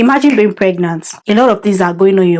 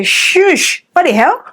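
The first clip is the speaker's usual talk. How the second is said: high sharp shush then outraged question; playful indignant scold; mock outrage; 9 semitones higher, slower than usual. Real, not acted. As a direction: shut the nosy asker up. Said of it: "reacting to nosy question".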